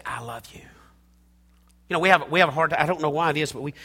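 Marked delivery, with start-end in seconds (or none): 0.0-0.6 soft and quiet; tender I love you. Shown as a demonstration. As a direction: say it softly to family. Tender modeled declaration.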